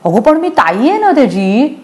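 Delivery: put-on high, pleading little-girl whine; whining. A narrator's reading. Little girl grumbling to her mother, high whine. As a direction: grumble it like a sulky child. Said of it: "Anu कुरकुरली".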